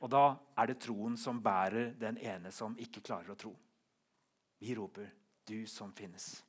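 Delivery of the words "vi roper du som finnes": soft, low, reverent close; quiet affirmation. Acted. Preacher repeating the hymn line for those who cannot believe, hushed. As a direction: let the line sink down gently.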